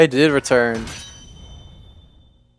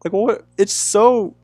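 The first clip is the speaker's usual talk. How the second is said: worked-up rant; 10 semitones higher, 5 dB louder than usual. Real, not acted. Ranting about ugly Exploud.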